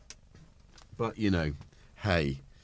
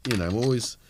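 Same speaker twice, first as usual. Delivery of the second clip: usual speaking voice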